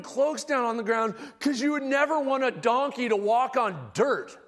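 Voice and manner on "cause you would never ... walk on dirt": normal talking voice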